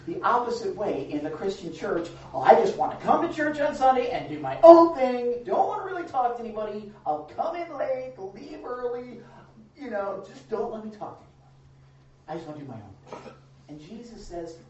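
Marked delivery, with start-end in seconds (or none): 2.3-13.3 mock selfish churchgoer.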